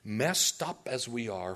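ordinary talk, nothing marked

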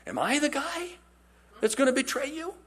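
high, worried plea; anxious disciple. Retold or imitated. Disciple asking Jesus, high and worried. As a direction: ask it high and anxious, afraid it's you.

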